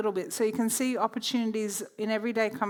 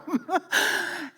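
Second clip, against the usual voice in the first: a long laugh up high; delighted high laugh; 8 semitones higher than usual. Real, not acted. Laughing after the quote.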